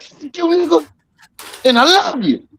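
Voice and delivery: high put-on uncle voice cussing then loving; mock uncle voice; uncle impression. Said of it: "be like".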